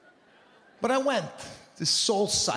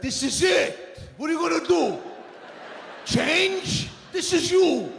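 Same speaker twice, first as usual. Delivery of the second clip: mock family shout; 10 semitones higher than usual. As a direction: bark it like a fed-up relative. Family mocking the idea of change.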